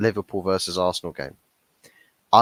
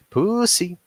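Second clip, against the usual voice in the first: higher still, taunting the word; sing-song taunt up high; mocking high; 8 semitones higher, 3 dB louder than usual. Vlogger taunting the referee, high mocking jeer.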